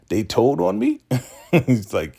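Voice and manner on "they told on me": high, rising shocked question; mock disbelief. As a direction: imitate someone caught out, voice shooting up. Acted shock at being told on.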